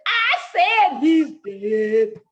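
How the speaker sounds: loud, slow, drawn-out announcement; emphatic acted shout; loud repeat. A narrator's reading. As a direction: holler it slowly for poor listeners. Rabbit repeats for those who didn't hear.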